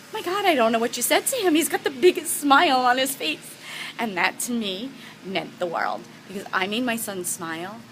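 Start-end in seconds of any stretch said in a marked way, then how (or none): 0.1-3.6 higher, louder astonished report; husband on the phone, surprised and delighted; amazed husband. Retold or imitated. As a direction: burst out in disbelief.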